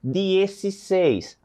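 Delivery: Dezesseis spoken very slowly with a falling end; slow model pronunciation. Shown as a demonstration. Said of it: "a entonação da minha voz".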